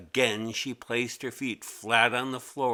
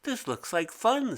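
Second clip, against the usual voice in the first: put-on much higher, bright little girl; young girl voice; 10 semitones higher than usual. A narrator's reading. Mimi cheerfully telling herself cursive looks fun, high girl voice. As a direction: pitch it up like an eager kid. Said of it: "said Mimi".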